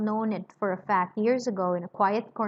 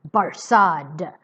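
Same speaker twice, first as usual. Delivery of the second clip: striking in; 3 semitones lower, 7 dB louder than usual. Acted. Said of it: "said another voice striking in".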